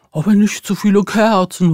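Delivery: owl hooting out her words; lightly hooting owl voice. A narrator's reading. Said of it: "heulte die Eule".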